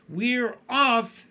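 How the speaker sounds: loud, high excited shout. A narrator's reading. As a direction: call it out with joy. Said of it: "someone was shouting".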